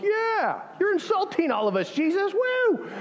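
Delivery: mock heckler whoop, pitched very high; rando shouting out. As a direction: imitate a random guy hollering in a high voice. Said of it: "rando guy that's just shouting like".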